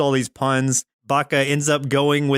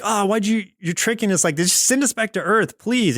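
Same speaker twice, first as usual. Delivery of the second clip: higher-pitched, whiny mock complaint; complaining kids; 7 semitones higher than usual. Kids to their kidnapper, complaining. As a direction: imitate kids pleading to be sent home. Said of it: "like, complaining".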